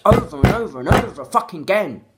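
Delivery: real exasperated shout; furious rant. Real, not acted. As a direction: hammer it out in real frustration. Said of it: "pissed off now".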